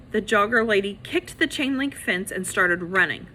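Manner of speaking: usual speaking voice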